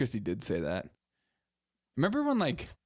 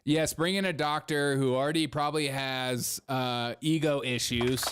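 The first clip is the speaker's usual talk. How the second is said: ordinary talk, nothing marked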